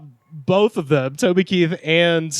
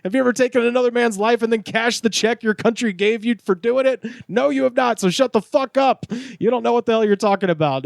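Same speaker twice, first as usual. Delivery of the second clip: much higher, hammed-up angry bark; mock furious veteran; 8 semitones higher than usual. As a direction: snap it back like a riled soldier. Retold quarrel, Chris voiced.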